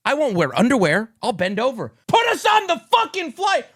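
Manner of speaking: builds to a high, loud shout; frustrated traveller yelling to be let on; rising rant to a shout. Real, not acted. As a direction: push it up till you're yelling. Ranting about getting on flight.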